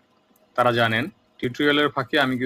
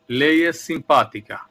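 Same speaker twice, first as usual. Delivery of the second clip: slow example sentence; 5 dB louder, much slower than usual. Shown as a demonstration. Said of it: "যেমন ধরুন".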